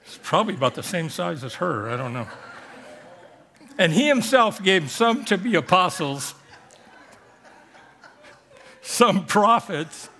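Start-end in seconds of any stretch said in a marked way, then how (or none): 3.8-10.1 scripture read through real laughter, louder; trying to read the verse but cracking up; laughing while reading. Real, not acted. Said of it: "I lost it right here".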